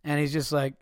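ordinary talk, nothing marked

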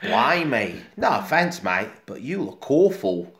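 cheeky London mate, blunt but friendly; Cockney cat. Retold or imitated. Cat tells the ape he looks awful, light Cockney. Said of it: "That's the cat talking".

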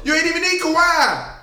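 high mocking taunt. Real, not acted. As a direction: rub it in, loudly. Taunting co-host.

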